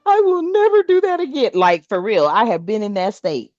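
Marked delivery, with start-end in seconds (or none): 0.0-1.5 slow, hammed-up apologetic whine; mock sorry. Caught by God, hammed-up tearful apology. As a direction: beg forgiveness in a mock cry. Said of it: "you be sitting there like".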